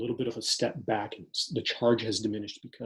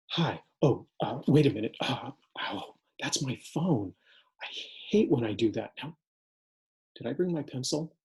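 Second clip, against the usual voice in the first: distracted student; 3 semitones higher than usual. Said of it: "imagine the student coming into the classroom".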